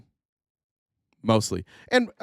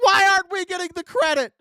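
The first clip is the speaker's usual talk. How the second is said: hammed-up angry complaint, pitched way up; Donald Trump, mock mad. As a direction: whine in mock outrage about being left out. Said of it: "Donald's just mad that other people".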